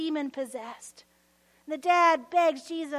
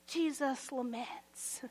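ordinary delivery

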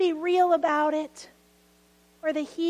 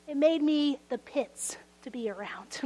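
hushed whispered confession; 2 semitones lower, 6 dB quieter than usual. Real, not acted. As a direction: whisper it ruefully. Confessing quietly.

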